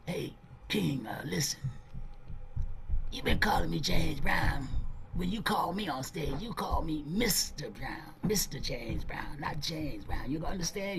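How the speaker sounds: soft, hushed warning. Retold or imitated. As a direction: lean in and whisper it firmly. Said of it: "[name] told him".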